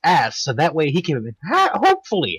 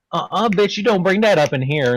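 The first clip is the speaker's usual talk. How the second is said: sassy attitude; 6 semitones higher, 5 dB louder than usual. Retold or imitated. Imitating the sassy attitude he gets, higher. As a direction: snap it back with mock sass.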